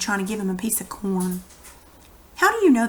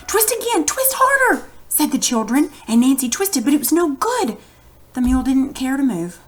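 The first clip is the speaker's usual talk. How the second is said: high, eager, much higher voice; excited children; 5 semitones higher, 3 dB louder than usual. Shown as a demonstration. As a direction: read it full of hope and excitement. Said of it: "read it like the children are very excited".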